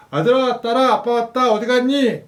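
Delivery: high loud playful call through the house. Acted. Dad coming home calling for his hidden kids, playful call. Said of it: "현관문을 엽니다".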